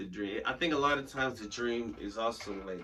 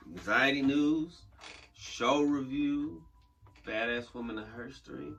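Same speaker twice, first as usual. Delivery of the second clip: announcer voice; 3 semitones higher, 5 dB louder, slower than usual. Acted. Announcing the show segments.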